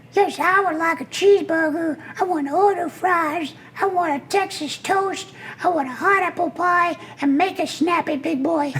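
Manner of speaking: very high little duck placing a brassy order; squeaky duck at a drive-in speaker. Acted. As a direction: order fast food as a cheeky duckling. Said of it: "the birth of my character".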